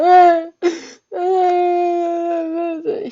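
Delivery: loud, high-pitched imitated bawling; drawn-out hammed-up sobbing "ah"; mock crying wail. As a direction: fake wailing cry.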